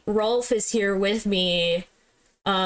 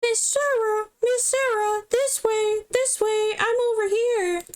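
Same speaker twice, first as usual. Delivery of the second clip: much higher, eager calling-over voice; high-pitched guide voice; 12 semitones higher than usual. Acted. Guide So calls Sarah over, much higher and eager. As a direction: beckon her up high like an excited guide. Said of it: "what kind of voice should I give So".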